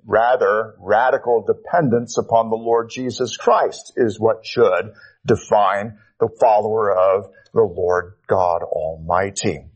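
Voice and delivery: ordinary talk, nothing marked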